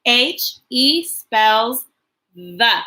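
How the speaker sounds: slow, drawn-out spell-through. Shown as a demonstration. Teacher slowly spelling out the word the class is learning. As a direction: stretch the letters, then land the word. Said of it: "It's gonna make a v sound".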